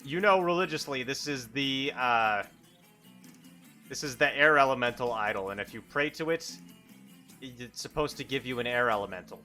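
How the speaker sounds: ordinary talk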